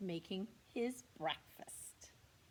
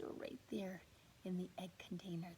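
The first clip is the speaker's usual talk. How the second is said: fast hushed whisper; 6 dB quieter than usual. Real, not acted. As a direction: whisper where the mouse hides. Aside to listening children.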